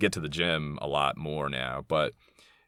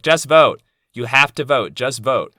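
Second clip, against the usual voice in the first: higher, louder insistent mock nagging; mock preachy voter; 7 semitones higher, 9 dB louder than usual. Mocking liberals lecturing minorities to vote, a loud nag. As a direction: hammer the slogan like a scold. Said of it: "where they're like".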